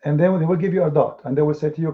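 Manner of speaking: usual speaking voice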